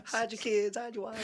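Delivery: high, soft meme imitation; hammed-up viral news quote; meme impression voice.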